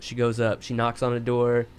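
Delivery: normal talking voice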